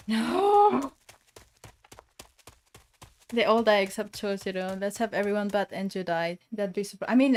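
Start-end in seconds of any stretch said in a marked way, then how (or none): 0.1-0.9 high, drawn-out cry of dismay. Real, not acted. Streamer watches a character bleed, high dismayed cry. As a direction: wail it in dismay. Reacts to blood on dying character.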